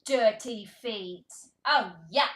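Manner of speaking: loud, slow exaggerated yuck; be hugely grossed out for a small child; mock disgust. Reader to toddler at dirty feet, loud playful disgust. Reacting to dirty cat picture.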